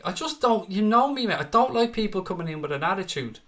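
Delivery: annoyed. Real, not acted. Ranting about a rude viewer.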